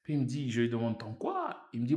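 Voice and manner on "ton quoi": high, softer incredulous query; baffled question. Retold or imitated. Newcomer asking friend about his char, high and baffled. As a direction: act out total puzzlement, pitched way up.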